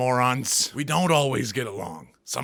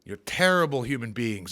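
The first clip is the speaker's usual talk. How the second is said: usual speaking voice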